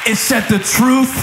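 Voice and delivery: high, shouted sermon climax; rising shouted preacher cadence; full-force preaching. Real, not acted.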